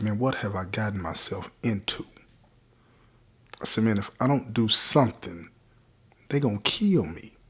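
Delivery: low, hushed, defeated self-talk; retold low point, spoken softly to oneself; understated despair. Beaten man talking to himself at the sink after crying, quiet despair. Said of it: "I started to cry".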